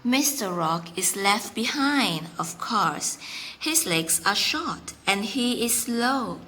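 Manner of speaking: ordinary delivery, nothing marked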